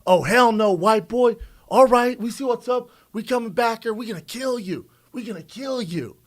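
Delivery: pimp threat. Retold or imitated. Comedian imitates the cuffed pimp threatening him, loud then high and menacing. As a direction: start yelling, then keep it high and menacing. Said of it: "He goes".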